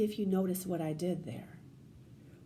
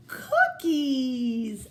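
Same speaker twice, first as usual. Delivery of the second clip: gasp, then a loud, thrilled cookies; excited gasp; 3 semitones higher, 7 dB louder than usual. Real, not acted. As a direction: sound amazed at the discovery. Teacher discovers chapter title.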